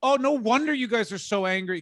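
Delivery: high, loud sudden realization; half-joking aha; mock realization. About punk bands, loud high mock realization. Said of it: "'cause you're just like".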